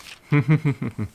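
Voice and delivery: light giggling laugh; giggle. A narrator's reading. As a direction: giggle with delight. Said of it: "He began to giggle".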